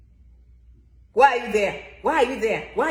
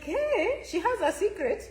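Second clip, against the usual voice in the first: high mock surprise on hey; sudden pleased surprise; surprised; 6 semitones higher, slower than usual. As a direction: act out the discovery. Her own surprised reaction.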